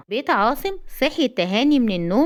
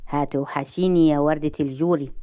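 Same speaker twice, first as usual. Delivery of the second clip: low-pitched, teasing affection; sly flirting; 6 semitones lower than usual. A narrator's reading. Gasser saying goodbye to blushing Roaa, slyly flirting. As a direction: murmur it with a sly smile. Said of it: "وابتسم بمكر".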